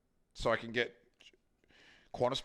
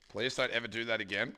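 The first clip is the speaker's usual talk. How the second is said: ordinary talk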